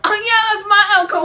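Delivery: high, loud, hammed-up gloating; mock show-off yell; excited bragging. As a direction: crow over it with a laugh. Acted gloating at seeing elk.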